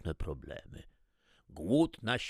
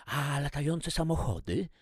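put-on higher curious kid's question; curious child; 8 semitones higher, 3 dB louder than usual. A narrator's reading. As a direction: ask like an eager boy. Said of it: "zainteresował się Marcel". (zaciekawione dziecko)

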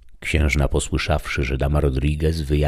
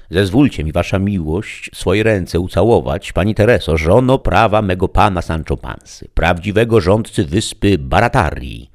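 higher, louder courtly address; courtly page; 4 semitones higher, 6 dB louder than usual. Acted. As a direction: kneel and pay elaborate court to the lady. (paź z przesadną dworskością, głośniej)

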